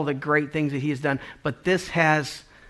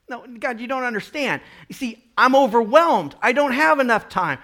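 pleading believer; 7 semitones higher, 4 dB louder than usual. Retold or imitated. Believer arguing with God.

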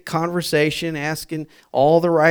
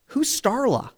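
mock-baffled woman, high and softer; baffled question; 7 semitones higher, 5 dB quieter than usual. Woman on the phone asks about an unknown name, baffled. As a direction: ask it high and small, lost.

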